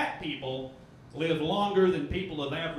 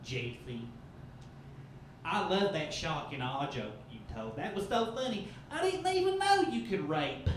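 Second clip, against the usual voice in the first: mom gushing, mangling rap; a hint of a Tennessee mom; southern mom; 3 dB quieter than usual. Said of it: "she goes".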